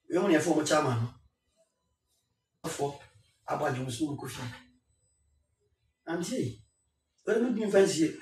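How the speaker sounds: ordinary talk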